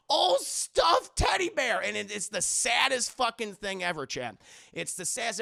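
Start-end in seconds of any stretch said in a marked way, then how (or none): 0.1-1.8 put-on high, slow, shouted "old stuffed teddy bear"; a teen flinging his teddy bear away with a high, slow yell; mock yell. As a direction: hurl the words like the bear itself. The son throws the teddy bear.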